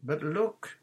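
high, amused protest; mildly laughing disbelief. A narrator's reading.

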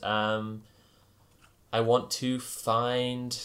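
normal talking voice

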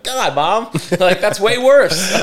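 high-pitched mock teenage whine; dismayed protest. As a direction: protest to Mom, drawn out and high. Kid protesting mom's harsher punishment.

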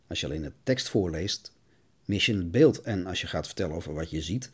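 ordinary talk, nothing marked

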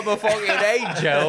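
high, hammed-up British accent; Alan Rickman. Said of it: "that was a bad impression of him".

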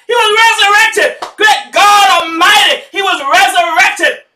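whooping gospel preacher; preaching at full force. Acted. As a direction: lift the church to the resurrection. Climax of Easter sermon.

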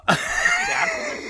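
real high-pitched laugh. Real, not acted. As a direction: let a squealing laugh burst out. Host laughing hard at the prank.

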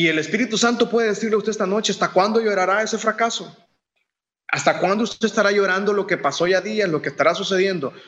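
urgent preacher's challenge; fervent preaching. Acted. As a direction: press the question on the listeners. Voicing the Spirit's rebuke.

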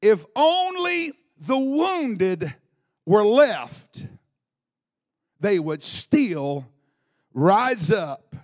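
lingering on each word of the verse; slow, weighty reading. Acted. Reading the verse to the congregation, slowly and weighty. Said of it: "One translation said".

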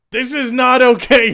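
high, loud panicked protest; mock alarmed shout. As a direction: cry out that it went too far. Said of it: "We're like".

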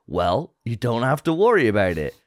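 ordinary delivery

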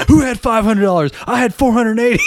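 high-pitched mock yell; excited high-voiced punchline shout; calling out. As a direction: pipe up high as an eager bidder in a joke. Dudes calling out bids in joke.

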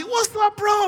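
put-on high, loud happy shout to an old buddy; excited greeting shout. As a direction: yell a joyful what's up. Greeting friends at surprise party.

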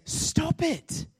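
sudden high, explosive shout; mock yell. Counselor giving a client his two-word cure, yelling. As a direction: bellow the punchline at full force.

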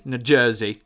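put-on "New Jersey" tried in a clumsy Jersey accent; an attempt at the Jersey guy's accent; bad New Jersey accent. Telling friends about a guy, trying a Jersey accent. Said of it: "This is a really bad accent".

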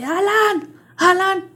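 high-pitched searching call. Retold or imitated. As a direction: call his name as if hunting for him, half shouted. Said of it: "y gritaba así".

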